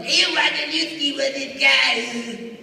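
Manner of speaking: lightly drawn-out cartoon character. Shown as a demonstration. Comic shows fans his old character, cartoon voice.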